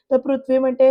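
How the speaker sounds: usual speaking voice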